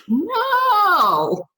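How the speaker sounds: sharp, high-pitched flat refusal; a big incredulous no; emphatic no. Retold or imitated. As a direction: shoot it down instantly, way up high. Refusing the doctor's opioid cure.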